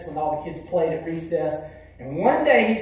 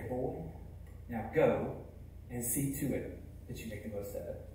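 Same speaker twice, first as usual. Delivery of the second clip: softer, low and tender blessing; gentle reassurance; 4 semitones lower, 9 dB quieter than usual. Retold or imitated. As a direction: comfort the ashamed boy softly. Preacher gently reassures the boy.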